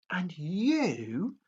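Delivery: usual speaking voice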